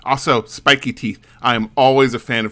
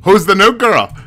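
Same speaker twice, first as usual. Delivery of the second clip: high, loud Scottish monster hunter; rowdy Scottish brogue, pitched up and punchy; Scottish accent; 7 semitones higher, 5 dB louder than usual. Acted. Monster hunter Crazy Eddie eyeing the new girl, loud Scottish accent. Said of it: "Is that Scotch enough".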